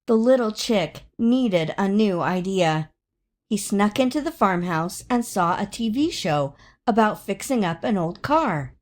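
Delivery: ordinary delivery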